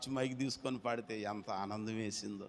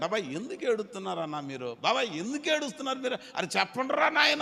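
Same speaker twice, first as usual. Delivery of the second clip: Moses pleading; 9 semitones higher, 4 dB louder than usual. Retold or imitated. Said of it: "అని అంటే".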